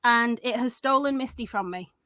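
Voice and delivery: ordinary talk, nothing marked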